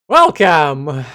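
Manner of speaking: booming, announcer-style welcome; loud welcome. Pretend. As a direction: call out Welcome like a host. Streamer echoes game greeting loudly.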